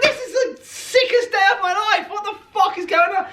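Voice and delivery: high, laughing squeal of disbelief; giddy disbelief. Retold or imitated. Tourist at a Japanese car meet, thrilled, in a high laughing squeal. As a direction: squeak it out like you can't believe your luck. Retold thrilled inner thought.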